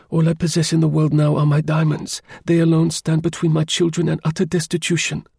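high, even-pitched countess voice; woman's voice. A narrator's reading. Countess explaining her plight to her helper, high woman's voice. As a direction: plead as a lady, pitched well up. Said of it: "came at one time from a woman's voice".

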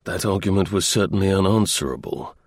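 ordinary delivery

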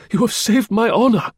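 overjoyed cry of thanks. A narrator's reading. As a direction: burst out thanking him, pitched high. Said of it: "he cried".

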